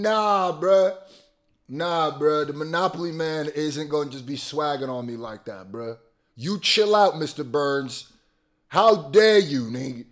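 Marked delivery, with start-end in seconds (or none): none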